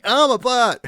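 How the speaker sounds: very high, loud yelp, replayed for laughs; retelling the ranger's yelp with glee; startled yelp. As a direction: shriek it as if jumping into the air. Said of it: "the way he jumped in the air going".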